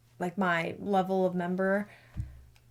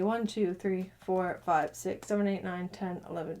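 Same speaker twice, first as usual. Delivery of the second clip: ordinary delivery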